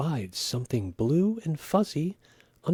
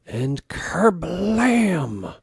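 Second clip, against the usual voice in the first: dramatic burst; 6 semitones higher, 6 dB louder, much slower than usual. A narrator's reading. Mitten bursts.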